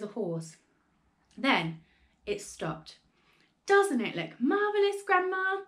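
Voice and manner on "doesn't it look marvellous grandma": high, excited calling; delighted shout. A narrator's reading. George showing Grandma the hen, high excited shout. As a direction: call up full of wonder. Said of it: "George shouted".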